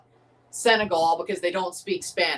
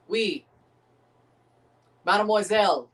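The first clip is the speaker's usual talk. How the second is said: usual speaking voice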